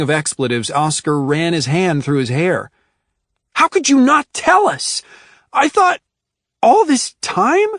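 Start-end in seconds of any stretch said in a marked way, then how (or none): 3.5-7.7 high, loud hurt demand; upset outburst. A narrator's reading. Oscar confronting Adrian over his secret, upset and loud. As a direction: fling the question at him in disbelief.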